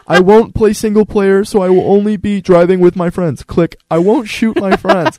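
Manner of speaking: put-on high, loud, slow pleading kid's sales pitch; kid pitching parents. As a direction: sell it like a kid begging for a game. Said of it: "I was like".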